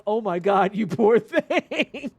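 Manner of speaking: drawn-out pitying laugh; laughing sympathy. Retold or imitated. Amused customer pitying the stressed cashier, slow laughing. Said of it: "she was just like".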